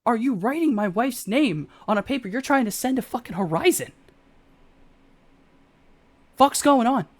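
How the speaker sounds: high, heated confrontation; acted outrage at his brother. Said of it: "if maybe slightly higher pitched".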